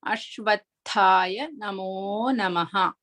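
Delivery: Ashwatthaya Namo Namaha slowly, each syllable clear; careful pronunciation. Shown as a demonstration. Teacher models the last quarter for students, slow and careful. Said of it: "Move to the last quarter".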